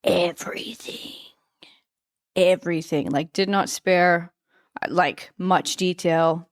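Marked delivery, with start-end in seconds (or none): 0.0-1.1 slow, voiceless whisper for emphasis; dramatic whisper. Real, not acted. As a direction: whisper it slowly, like a confession. Dramatic whispered confession, then repeated aloud.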